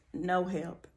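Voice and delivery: ordinary delivery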